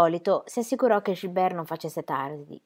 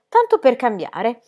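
borbottio sarcastico; 6 semitones higher, 8 dB louder, slower than usual. A narrator's reading. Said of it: "borbottò André".

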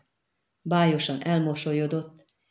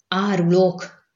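raised, drawn-out cry of accusation; mildly yelled, louder accusation; angry yell; 3 semitones higher, 6 dB louder, slower than usual. A narrator's reading. Dakre turns on the deserting comrades, a mild angry yell. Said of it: "ordította Dakre".

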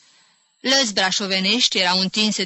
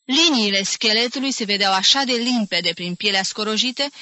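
ordinary delivery, nothing marked

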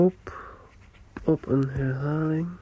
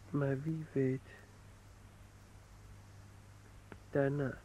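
growing softer until barely heard; fading softness; 14 dB quieter than usual. Acted. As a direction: let the thought drift away. Letter trailing into reverie. (steeds zachter wegvallend)

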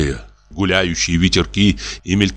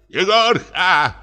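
high, drawn-out call into the dark; 12 semitones higher, much slower than usual. A narrator's reading. Old comic calling for the watchman, high drawn-out call. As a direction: call the name across the empty hall. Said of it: "крикнул он".

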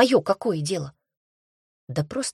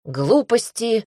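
irritated father; 4 semitones higher, 4 dB louder, slower than usual. A narrator's reading. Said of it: "сердился Майрон".

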